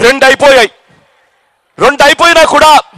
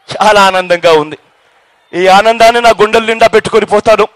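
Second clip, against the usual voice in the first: lower, measured and sincere, in short phrases; heartfelt thanks; 6 semitones lower than usual. Real, not acted. Speaker thanking the crowd for their love and blessings, heartfelt and lower. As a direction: bring the voice down to thank them humbly, pausing often.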